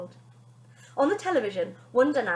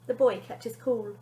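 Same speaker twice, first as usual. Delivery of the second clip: ordinary delivery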